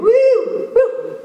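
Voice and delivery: high loud woo; joyful whoop. Acted. Girl hopping down branches, joyful whoop. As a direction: whoop as she leaps. Comes flying through.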